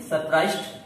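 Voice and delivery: usual speaking voice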